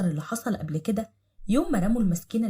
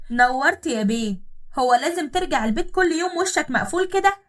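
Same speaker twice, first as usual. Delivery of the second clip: sarcastic scolding; 4 semitones higher, 5 dB louder than usual. Acted. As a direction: nag and jeer at him, louder and louder. Wife mocks and berates husband. (زوجة تسخر من زوجها وتوبخه بصوت عالٍ)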